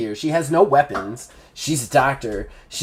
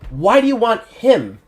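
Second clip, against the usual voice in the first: higher, puzzled questioning; Pencil Thin, puzzled; 7 semitones higher, 3 dB louder than usual. Acted. Pencil Thin questioning the thugs, puzzled and higher. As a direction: press them on why they want him. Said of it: "She just continues to talk".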